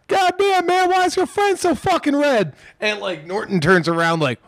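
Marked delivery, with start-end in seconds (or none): 0.1-2.5 much higher, mocking stranger's voice; mock heckling stranger. Passerby yelling at them about a red friend, in a high mock voice. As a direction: squeak it out like a gawking passerby.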